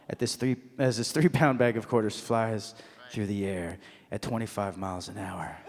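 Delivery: soft, low narration fading away; hushed suspense. Acted. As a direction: let it sink to a near murmur as the bag flies. Slow-motion suspense as bag flies.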